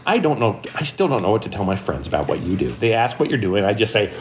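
high, bewildered mom voice; imitating mom. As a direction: mom who doesn't get it. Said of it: "My mom says every time I see her".

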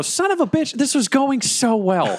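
high strained exclamation; pained character outburst. Acted. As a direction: cry out, hurt but wry. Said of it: "I lean forward and go".

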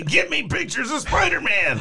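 very high, loud shouted editor demand; barking newspaper editor. Retold or imitated. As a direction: yell the order like a newspaper boss. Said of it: "I've been practicing".

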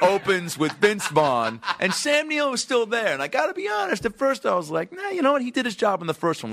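high-pitched, insistent comic tirade; worked-up rant. Real, not acted. Comic refusing to drop Jurassic Park, ranting. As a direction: rant on high, holding the floor. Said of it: "I got a Jurassic Park rant".

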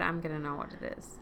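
creaky low fry on the sentence end; vocal fry. Real, not acted. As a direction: let the voice crackle down into fry. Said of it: "what you just did at the end of the sentence would be vocal fry".

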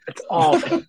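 "awful" jumps way up in a high squeal; exasperated high-pitched "awful"; squeaky high repeat. Real, not acted.

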